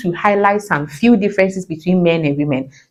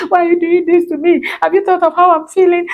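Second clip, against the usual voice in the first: much higher, mock-wounded wife voice; whiny emotional plea; 11 semitones higher than usual. Pretend wife pleading with her husband about her feelings, high and whiny. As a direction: ham up the hurt feelings, pitched way up.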